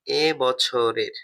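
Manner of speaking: "এ বছরের" drawn out very slowly, word by word; dictating "এ বছরের" at a crawl; slow dictation. Shown as a demonstration. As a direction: spell "এ বছরের" out slowly so students can write. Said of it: "teacher repeats the phrase".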